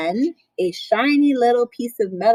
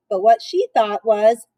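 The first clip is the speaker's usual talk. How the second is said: ordinary delivery, nothing marked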